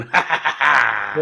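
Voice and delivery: slow mock evil laugh; villain laugh. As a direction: cackle like a cartoon villain. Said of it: "a lot of him going".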